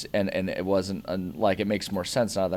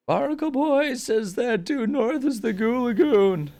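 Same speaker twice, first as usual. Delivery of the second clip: put-on very high, loud, slow old man; panicked old guy voice; 6 dB louder than usual. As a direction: quaver it out like a panicky old hero. Cartoon character voice.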